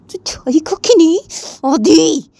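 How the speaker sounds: hissed demand. Acted. Jiya warning Adi to go, hissed. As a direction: hiss it at him.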